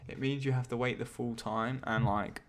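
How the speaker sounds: ordinary talk, nothing marked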